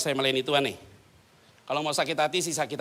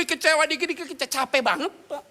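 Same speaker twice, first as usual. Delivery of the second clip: higher, loud whine; high, loud mock whine; whiny complaining congregant; 12 semitones higher, 5 dB louder than usual. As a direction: moan it like a tired churchgoer.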